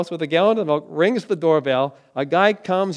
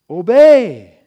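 emphatic shouted command; 3 semitones higher, 13 dB louder than usual. Real, not acted. Preacher drives home Jesus' command to listen, emphatic shout. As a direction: boom out the single word, drawn out.

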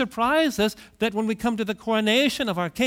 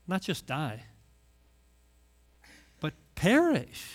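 ordinary talk, nothing marked

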